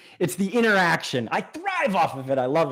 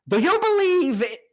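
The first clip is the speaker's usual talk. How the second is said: high mock voice for Steve; lightly voiced viewer question; imitating viewer. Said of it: "you don't sound like this".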